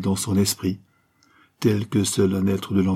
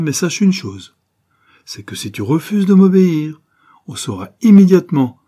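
rising threat; 8 semitones higher, 9 dB louder than usual. Acted. Diana threatens her lover.

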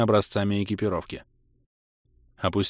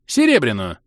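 cheeky elf; 4 dB louder than usual. Acted. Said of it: "нахально заявил эльф".

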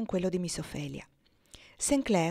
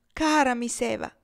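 exclaiming; 5 semitones higher, 5 dB louder than usual. A narrator's reading. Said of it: "esclamò la fanciulla".

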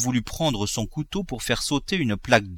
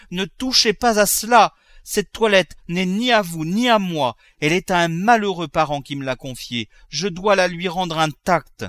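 high, urgent, insistent entreaty; desperate pleading; 8 semitones higher, 4 dB louder than usual. Acted. Daughter on her knees begging her father, desperate pleading. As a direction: implore him with rising force.

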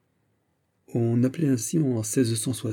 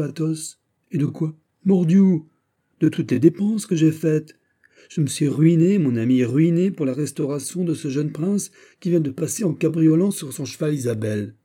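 higher, louder Gascon musketeer venting; heated d'Artagnan; 6 semitones higher, 4 dB louder than usual. A narrator's reading. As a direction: complain bitterly to his friend, rising. D'Artagnan complains of ruin. (d'Artagnan qui s'emporte, plus aigu et plus fort)

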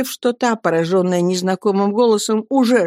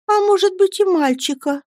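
put-on higher, shy young mother; timid remark; 12 semitones higher than usual. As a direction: venture it timidly. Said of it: "осмелилась заметить матушка". (робко, высоким голосом)

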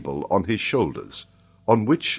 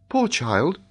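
lightly voiced girl, higher pitch; a hint of a pitying girl, pitched up; 9 semitones higher than usual. A narrator's reading. Mary Grant pities sleeping child.

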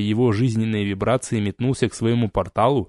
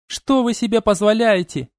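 indignant outburst; 10 semitones higher than usual. Acted. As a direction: demand it in shock and fury. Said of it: "возмутилась Элани".

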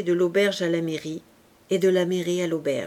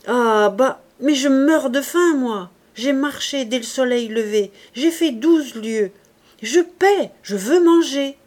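loud, angry, climbing higher; hungry outrage; 5 semitones higher, 8 dB louder than usual. A narrator's reading. Starving traveler shouting at the innkeeper, angry and loud. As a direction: demand the meal as a paying man.

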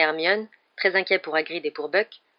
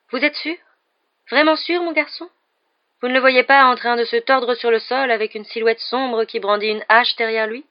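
high-pitched, pressing divination teacher; insistent seer; 6 semitones higher, 8 dB louder than usual. A narrator's reading. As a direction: press the pupil for a gory vision.

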